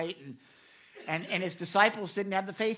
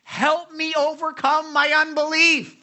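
much higher, louder, slow pleading cry; 10 semitones higher, 11 dB louder than usual. Retold or imitated. Father begs Jesus for help, loud slow plea. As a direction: cry out for help, stretching the words. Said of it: "he said this".

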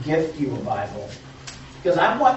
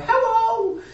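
put-on much higher, louder little-boy greeting; Zeke voice; 7 dB louder than usual. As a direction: chirp it at the door like a small kid. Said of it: "that's my Zeke voice".

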